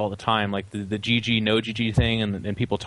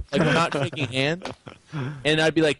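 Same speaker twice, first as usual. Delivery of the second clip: usual speaking voice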